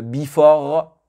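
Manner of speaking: "before" as "bee-for", heavy first syllable and French r; French-accented mispronunciation. Shown as a demonstration. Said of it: "en tant que francophone".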